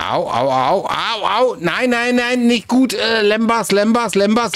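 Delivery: high, loud frantic cries of pain; panicked yelping. Real, not acted. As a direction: yelp in panic as you're hit. Taking damage in a fight.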